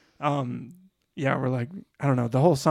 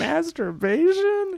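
mock shocked question; 13 semitones higher, much slower than usual. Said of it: "She's like".

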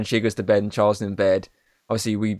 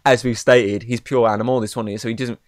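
normal talking voice

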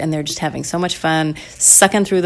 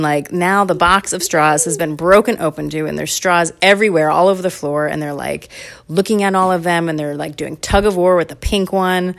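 plain reading voice

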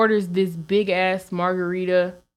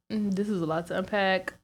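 ordinary talk, nothing marked

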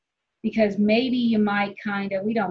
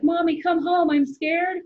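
put-on higher, pleading child voice; scared little boy; 9 semitones higher, 3 dB louder, slower than usual. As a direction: play the son as a frightened kid begging mom to come home. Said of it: "like he really did wanna be like".

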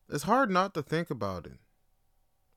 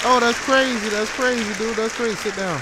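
hyped; 9 semitones higher, 10 dB louder than usual. Acted. Comic skit opening, hyped voices.

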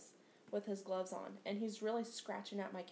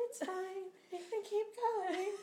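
high, slow, strained "it's fine"; mock brave voice hiding the pain; forced brave reply; 10 semitones higher, slower than usual. Telling the pedicurist it doesn't hurt, high and strained.

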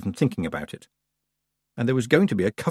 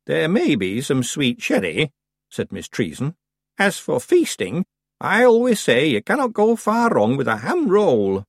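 put-on old witch voice; 6 semitones higher than usual. A narrator's reading.

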